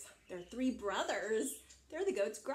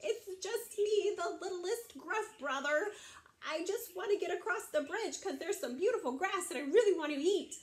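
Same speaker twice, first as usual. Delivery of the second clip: high, small goat voice; little scared goat; 8 semitones higher than usual. Acted. Littlest billy goat answering the big troll, a little scared. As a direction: plead timidly in a high voice. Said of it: "he's a little scared".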